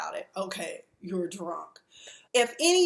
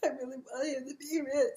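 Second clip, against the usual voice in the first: mock sobbing, higher and softer; fake crying; 5 semitones higher, 4 dB quieter than usual. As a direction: mimic crocodile tears. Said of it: "Ain't no tears coming out of her eyes".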